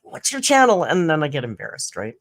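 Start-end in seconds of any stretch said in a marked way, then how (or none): 0.0-0.8 eager. Retold or imitated. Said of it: "I go".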